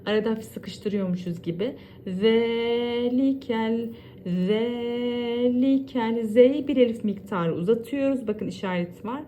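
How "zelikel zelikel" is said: Zelikel slow, tongue touching the upper teeth for the Z; lisped Z, drawn out. Shown as a demonstration. Teacher showing students the lisped Z, slowly. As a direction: stretch the Z of zelikel one beat. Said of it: "Dilimizi ön dişlerimizin".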